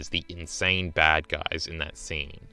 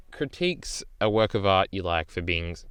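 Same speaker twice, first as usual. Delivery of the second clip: ordinary talk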